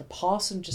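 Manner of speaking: "passenger" with a wrongly long "ah", as "pahssenger"; mock British vowels. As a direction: overdo the first vowel of "passenger" like a bad British accent. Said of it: "must make use of only long vowel sounds".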